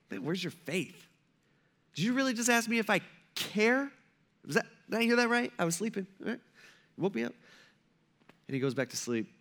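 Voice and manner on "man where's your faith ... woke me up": soft, incredulous grumble of someone just woken; put-out scolding. Acted. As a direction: grumble quietly in mock disbelief. Woken Jesus scolds his disciples.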